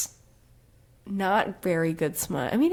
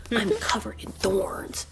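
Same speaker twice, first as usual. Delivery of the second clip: hushed mock-tragic whisper. Podcaster mocks the heroine's self-pity, whispering. Mocking Feyre's line again.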